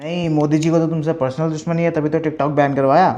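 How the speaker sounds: ordinary delivery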